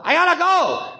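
high-pitched exasperated gangster; Eddie Spomoso. Acted. Comic pretends he can't get off the phone, exasperated. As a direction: pitch jumps way up, pleading to get off. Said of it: "Eddie Spomoso".

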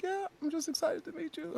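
tiny, very high, soft squeak; imitating crying fan. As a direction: mimic an overwhelmed girl in a small high voice. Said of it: "she's crying".

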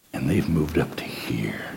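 hushed, slow whisper; slow confiding whisper from the pulpit. Acted. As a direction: drop to a secret whisper. Preacher whispers someone's growth.

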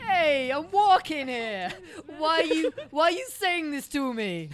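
loud, drawn-out mock New Yorker; New York accent. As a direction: ham up an indignant New Yorker, slowly. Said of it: "a New Yorker".

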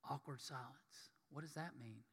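hushed, uncomfortable confusion; awkward hush. Acted. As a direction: act out puzzled murmuring. Acts awkward moment among disciples.

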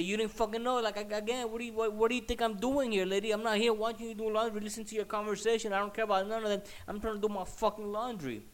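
high, loud exasperated rant; re-enacted indignant outburst; angry confrontation. Acted quarrel with the lady.

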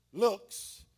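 ordinary delivery

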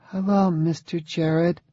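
put-on high, soft little girl, unsure of herself; a shy child greeting a stranger; small, uncertain voice. A narrator's reading. Young daughter greets her father's friend, small and uncertain. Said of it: "in a small, uncertain voice".

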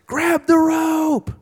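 high, loud, drawn-out call; rescuer's shout. Acted. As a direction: shout it slowly across roaring water. Rescuer calling to drowning man.

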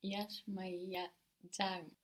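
slow, careful learner's attempt; drawn-out practice pronunciation; slowly. Shown as a demonstration. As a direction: sound it out slowly, bit by bit. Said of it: "Do it slowly".

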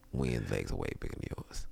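soft whispered aside. Retold or imitated. Judge whispering to Brown on the rec yard, hushed. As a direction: whisper it like a secret.

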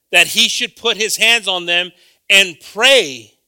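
loud, high Spanish scripture read; preaching Bible reading. Acted. Preacher reading scripture aloud to congregation, loud. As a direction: proclaim the verse to the church.

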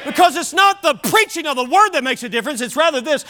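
high, emphatic pulpit exhortation; forceful preaching. Real, not acted. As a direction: drive each key word home, slowly at the climax. Exhorts the congregation.

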